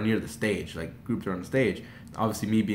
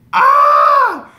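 loud, very high fangirl scream; piercingly high scream of starstruck joy; excited scream; 15 dB louder than usual. Retold or imitated. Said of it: "I was screaming".

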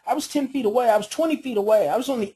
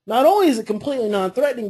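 usual speaking voice

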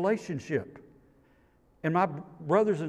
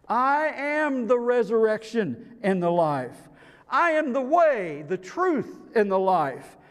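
rising, louder preacher's cry; preaching at full force; 8 semitones higher, 5 dB louder than usual. Acted. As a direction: lift the congregation with each claim.

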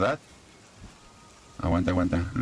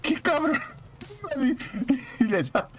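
high, laughing outburst; laughing exclamation. Real, not acted. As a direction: cry out through laughter. Reacts to bird fleeing. (exclamación aguda entre risas)

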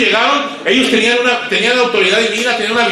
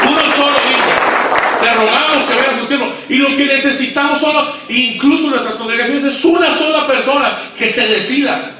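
full-force preaching; 5 semitones higher than usual. Acted. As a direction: preach it out to the pastors.

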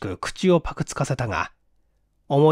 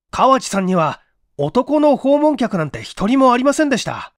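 louder and higher, pushing each word out; forceful denial; 8 semitones higher, 5 dB louder than usual. Acted. Okamoto denying to the inspector, forcefully and louder. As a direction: insist hard that no man ever came. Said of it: "力を入れて".

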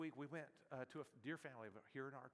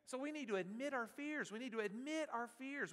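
normal talking voice

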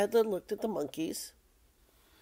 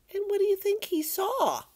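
put-on high-pitched, wondering question to children; storyteller's high, teasing question; suspenseful question; 12 semitones higher, faster than usual. A narrator's reading. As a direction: pitch it up high and ask the listeners.

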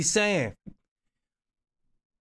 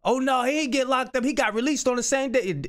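higher, scandalized mock outrage; mocking gossip; 7 semitones higher than usual. Community gossiping about a rapper's arrest, in higher mock outrage. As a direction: ham up the gossiping crowd.

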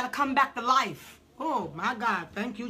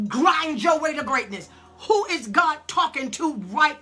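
preaching at full force; 7 semitones higher, 8 dB louder than usual. Real, not acted. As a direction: drive it home to the congregation.

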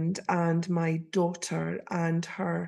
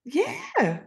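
eager insisting; 9 semitones higher, 3 dB louder, slower than usual. Retold or imitated. Insisting she knows her.

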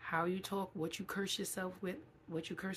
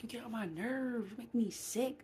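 fed-up complaint; 6 semitones higher than usual. Retold or imitated. Mimicking someone fed up, slapping thighs.

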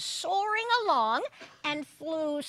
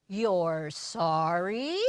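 lower, very slow tender ask; gentle question; 7 semitones lower, much slower than usual. Acted. God answering Ziz's apology, tender. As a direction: wonder at it gently. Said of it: "God asked".

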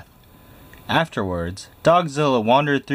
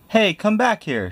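much higher calling shout; shouting after troops; 11 semitones higher than usual. Acted. The Big Cheese calling after his fleeing troops, shouting. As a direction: call the fleeing soldiers back. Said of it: "shouted the Big Cheese to his troops".

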